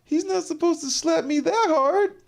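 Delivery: high-pitched, whiny mock-crying voice; crying wrestler. Slapped wrestler protests it should have been fake, high tearful whine. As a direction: imitate a slapped wrestler about to cry, very high. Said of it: "He's like".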